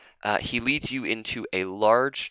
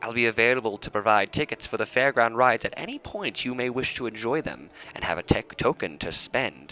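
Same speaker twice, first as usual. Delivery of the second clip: bright showman, higher; cheerful guide voice; 4 semitones higher, 4 dB louder than usual. Acted. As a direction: hurry them along like a carnival host. Atrebus voice.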